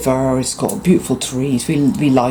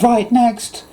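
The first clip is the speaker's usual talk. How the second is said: cashier again; 9 semitones higher than usual. Retold or imitated. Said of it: "And he went".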